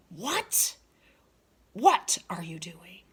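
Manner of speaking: hissed angry demand, half whispered; teacher demonstrating Granny's angry expression; furious granny. Acted. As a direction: snap at her like a furious granny. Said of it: "The expression in my voice is anger".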